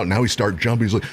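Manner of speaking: ordinary talk, nothing marked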